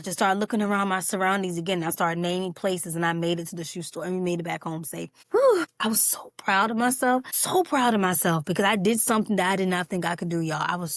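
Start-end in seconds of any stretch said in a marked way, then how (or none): 5.3-5.6 loud, high exhaled whew; relieved whew. Real, not acted. Driver finally back home safe, loud relieved whew. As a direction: blow out a big sigh of relief.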